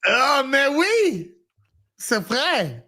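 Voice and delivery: high, slow mock French accent; a hammy French flourish. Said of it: "read in fresh, uh, French accent".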